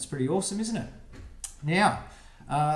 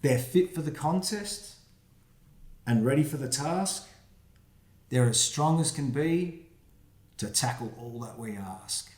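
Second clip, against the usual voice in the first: put-on reading to children. Reads rhyming picture book aloud.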